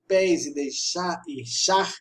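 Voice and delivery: ordinary delivery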